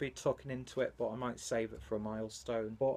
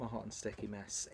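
a softer, weary echo of the phrase; softer resigned repeat; 8 dB quieter than usual. Shown as a demonstration. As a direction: drop the voice and repeat it, deflated. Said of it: "I look like a hot and sticky mess".

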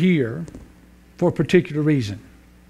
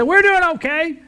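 much higher wife voice; wife answering the attendant, much higher; hard-of-hearing wife; 11 semitones higher, 4 dB louder than usual. Acted. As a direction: pitch her up into the wife's voice.

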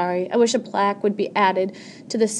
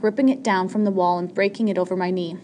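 plain reading voice

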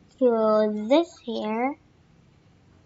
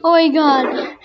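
ordinary talk, nothing marked

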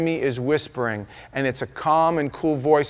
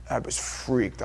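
normal talking voice

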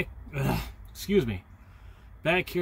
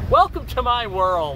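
laughing to himself; 4 semitones higher, much slower than usual. Real, not acted. Laughing at his own repeating.

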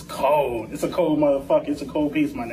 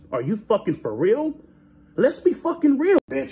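ordinary delivery

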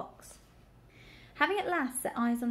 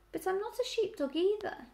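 high, earnest piglet voice; protesting piglet; 9 semitones higher than usual. A narrator's reading. Piglet correcting the suspicious sheep, protesting. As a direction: protest in a raised, childlike pitch.

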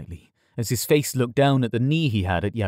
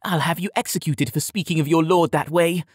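raised-pitch, indignant threat; threatening; 6 semitones higher than usual. A narrator's reading. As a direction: threaten him like an affronted young lord. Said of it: "Yared threatened".

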